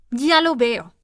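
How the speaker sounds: exploding in anger. A narrator's reading. Queen exploding at the kneeling gardener. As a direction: burst out at him. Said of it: "estalló la reina".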